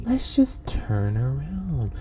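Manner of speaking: slow, low and soft; quiet, measured self-restraint; forced calm. Acted. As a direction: pull right back after an outburst. Restraining self after outburst.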